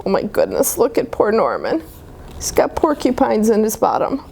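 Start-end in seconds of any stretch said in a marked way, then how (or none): none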